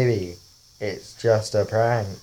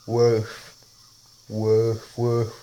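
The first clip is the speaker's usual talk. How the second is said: playful spoken woofs; dog bark; much slower than usual. Acted. Playing the dog filter for viewers, with spoken barks. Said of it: "The last one is the dog".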